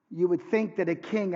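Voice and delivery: ordinary delivery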